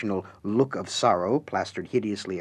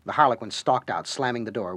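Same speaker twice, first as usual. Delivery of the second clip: ordinary delivery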